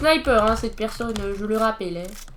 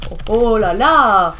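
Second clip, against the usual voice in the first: slow, louder dismay; a gamer seeing trouble coming, slowly exclaiming in alarm; 3 semitones lower, 7 dB louder, slower than usual. Real, not acted. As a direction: stretch it out in real alarm. Alarmed in game.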